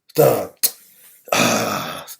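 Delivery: ordinary delivery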